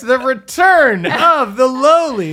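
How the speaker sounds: announcer voice. Pretend. Announcing guest's grand return.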